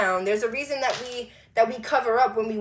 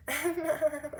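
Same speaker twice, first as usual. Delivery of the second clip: soft, higher fake titter; forced polite laugh; 4 semitones higher, 8 dB quieter than usual. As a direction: laugh quietly through discomfort. Polite reaction to unwanted touching.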